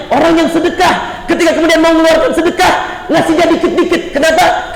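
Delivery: high-pitched, driving exhortation; forceful preaching. Real, not acted. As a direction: preach it in rising, insistent waves. Preaches at full force to congregation.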